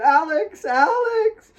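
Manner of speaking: high crying scream; anguished high scream; screaming his name. A narrator's reading. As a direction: scream for him, desperate. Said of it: "She screamed for him".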